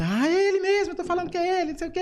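squealing high and loud; excited fan. Retold or imitated. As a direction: shriek it like a starstruck fan. Excited fan recognizing him.

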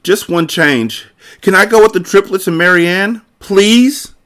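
put-on high-pitched eager kid's plea; pleading cry. A narrator's reading. Nikki begs to join the triplets, pleading cry. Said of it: "But Nikki jumped up and cried".

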